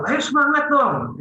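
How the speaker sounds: high-pitched, eager urging; spirited pep talk. Acted. Trainer rallying the participants, high spirited encouragement. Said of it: "intonasi yang bersemangat".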